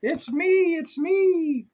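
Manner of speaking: very high, bright sheep voice. Acted. Sheep revealing itself to the child, very high. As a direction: squeak it out like a little sheep. Horse answers in story.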